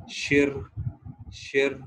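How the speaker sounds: शिर with tongue curled back; pinyin sh sound. Shown as a demonstration. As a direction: demonstrate शिर twice. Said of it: "एसएच sound देगा". (एसएच की ध्वनि शिर)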